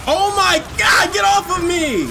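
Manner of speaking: real panic, fighting them off; panicked shout. Real, not acted. Gamer swarmed by enemies, panicked shout.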